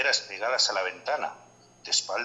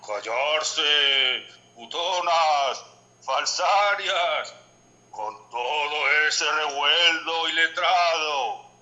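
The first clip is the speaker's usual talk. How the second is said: higher, louder, slow dead man's voice; lugubrious, tremendous voice; 5 semitones higher, 6 dB louder, much slower than usual. Acted. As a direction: boom it out slowly from beyond the grave. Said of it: "con voz lúgubre y tremenda". (voz lúgubre y tremenda)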